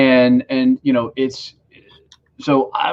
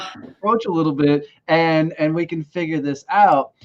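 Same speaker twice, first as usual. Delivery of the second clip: sweet coaxing; 6 semitones higher than usual. Retold or imitated. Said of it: "I'm like".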